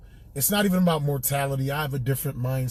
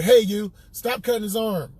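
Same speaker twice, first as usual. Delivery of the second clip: higher, lightly hammed hillbilly accent; Gary Owen hillbilly voice; 6 semitones higher, 3 dB louder than usual. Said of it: "making Gary sound like a hillbilly".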